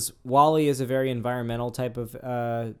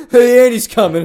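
higher, much louder holler; hammed-up warning shout; mock shout; 8 semitones higher, 14 dB louder than usual. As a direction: yell it as an imitated prankster. Imitating someone shouting the warning.

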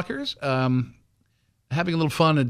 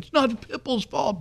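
mock pit bull owner; 10 semitones higher, 3 dB quieter than usual. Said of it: "the pit bull people are like".

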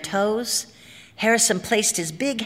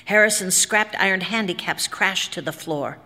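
ordinary delivery, nothing marked

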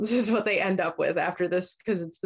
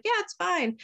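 high, airy reassurance; imitated governess; 11 semitones higher than usual. Governess answering the worried mom, high mock-breezy voice. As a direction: play a governess brushing it off in a high voice. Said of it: "she calls up the governess".

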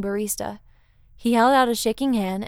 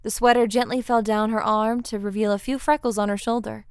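ordinary delivery

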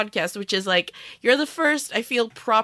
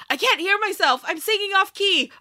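mock angry; 9 semitones higher than usual. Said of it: "he got so mad because he was like".

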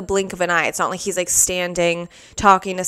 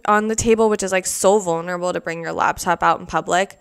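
usual speaking voice

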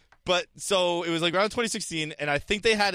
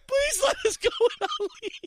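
high, whiny begging impression; mock desperate plea. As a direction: whimper it like a leader begging to quit. Imitating Theresa May begging.

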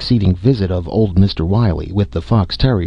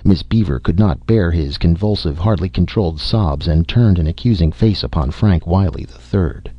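ordinary delivery

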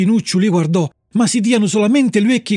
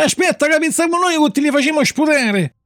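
high, goading robber voice; bullying threat; 7 semitones higher than usual. A narrator's reading. Robbers warn the puppet they'll make him spit, bullying. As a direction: taunt him in a raised pitch.

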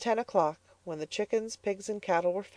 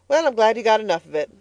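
louder, quick and cutting; sharp retort; 5 semitones higher, 7 dB louder, faster than usual. Acted. Mother scornfully answering her daughter, louder and quick. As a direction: snap back at the girl.

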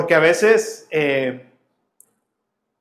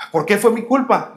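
surprised question; 5 semitones higher, 4 dB louder than usual. Retold or imitated. Father surprised.

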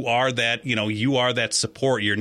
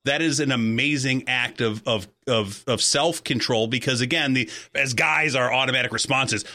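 usual speaking voice

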